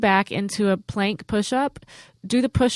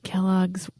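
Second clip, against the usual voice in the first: hushed headline whisper; 2 semitones lower, 8 dB quieter than usual. Acted. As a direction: breathe the headline like a secret. Reads headline.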